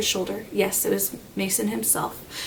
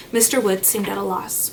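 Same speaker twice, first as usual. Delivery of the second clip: ordinary delivery, nothing marked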